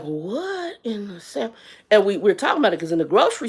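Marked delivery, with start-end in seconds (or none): 0.0-1.5 slow, hushed, stunned "what in the Sam"; a hushed, drawn-out gasp of disbelief. Retold or imitated. Shocked shopper reacting under her breath to rude people. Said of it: "I was like".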